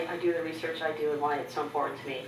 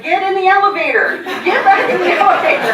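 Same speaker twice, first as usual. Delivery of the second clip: panicked shout; 12 semitones higher, 14 dB louder than usual. Retold or imitated. Psychologist panics at her sobbing.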